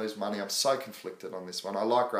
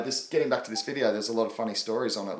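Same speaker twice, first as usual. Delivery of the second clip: normal talking voice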